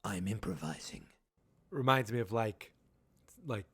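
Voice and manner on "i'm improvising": low, soft action-hero line; cool deadpan. Retold or imitated. Hopper answering what are you doing, low cool deadpan. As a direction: drop the voice and play it cool. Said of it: "It was a very kind of, like, cool moment".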